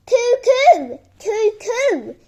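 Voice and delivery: high, loud bird call down the chimney; cuckoo call. Acted. Reggie calling down the chimney as a cuckoo.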